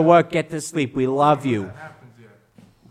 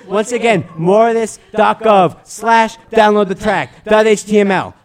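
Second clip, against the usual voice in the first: promo plug; 5 dB louder than usual. Acted.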